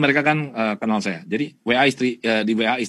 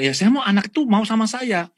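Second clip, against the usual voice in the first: high, loud, aggrieved complaint of a hurt father; frustrated father; 10 semitones higher, 4 dB louder than usual. Retold or imitated. Father complaining to the counsellor, high and aggrieved. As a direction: plead like a father thrown out of his own son's room. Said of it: "Dia bilang".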